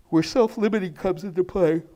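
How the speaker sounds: sleepy, yawning through the words. Real, not acted. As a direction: talk through a yawn. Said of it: "I don't know why I'm so yawny".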